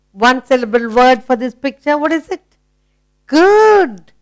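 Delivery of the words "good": high, loud burst of praise; excited praise. Real, not acted. Teacher to child, a high excited good. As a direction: reward the answer with a squeal of good.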